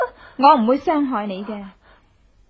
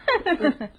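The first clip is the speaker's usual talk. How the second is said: short, higher gloating laugh; mocking laugh; 5 semitones higher than usual. Woman mocks the paralysed man with a short laugh. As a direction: laugh down at him, scornful.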